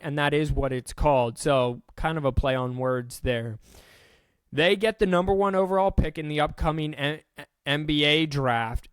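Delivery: ordinary talk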